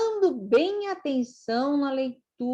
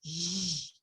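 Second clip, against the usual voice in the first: low, quiet "zh" buzz; soft G sound; 5 semitones lower, 10 dB quieter than usual. Shown as a demonstration. Teacher showing learners the soft sound of G, held "zh". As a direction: hold a soft "zhh" before the word. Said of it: "ela pode ser assim".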